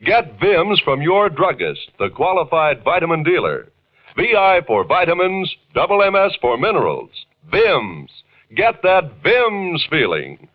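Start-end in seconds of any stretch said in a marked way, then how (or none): none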